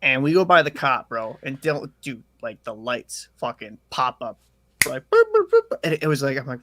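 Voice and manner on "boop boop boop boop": short high electronic boops; high-pitched siren blips; imitated police siren. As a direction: mimic police lights blipping on, high and loud. Imitating police lights popping up.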